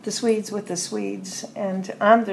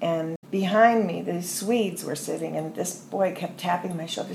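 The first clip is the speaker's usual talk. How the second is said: ordinary talk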